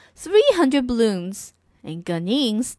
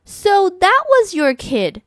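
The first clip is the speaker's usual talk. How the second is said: higher, louder, slow librarian; librarian realising; 8 semitones higher, 6 dB louder, slower than usual. Acted. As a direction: draw it out as she realises. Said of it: "said the librarian".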